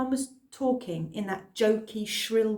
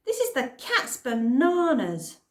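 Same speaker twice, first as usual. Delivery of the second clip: excited; 5 semitones higher, 6 dB louder than usual. Acted. Cliff excited by planes.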